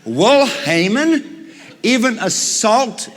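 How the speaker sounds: high, slow, loud outraged king. Acted. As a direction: hammer each word in disbelief. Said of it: "and he says".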